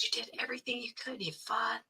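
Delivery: a soft whisper of comfort; hushed reassurance. Retold or imitated. Police chaplain whispering comfort to a grieving sister. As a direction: lean in and whisper it gently, as if consoling.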